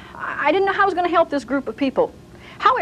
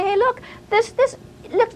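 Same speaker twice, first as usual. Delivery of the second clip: a hint of [name] rebuking; 10 semitones higher than usual.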